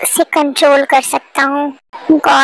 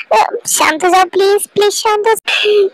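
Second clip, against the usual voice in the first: normal talking voice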